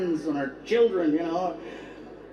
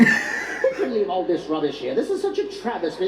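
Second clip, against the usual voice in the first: indignant, very proper mock English; proper British accent; 3 semitones higher than usual. Englishman fussing over trash, mock British accent. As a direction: complain in a hammed-up British accent, pitch climbing. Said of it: "The accent is so proper".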